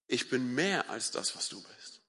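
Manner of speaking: slow, soft and low, sinking to a whisper; quiet divine reply. Acted. God answering a believer who feels a wreck, quiet divine reply. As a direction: reassure slowly, fading to a hush.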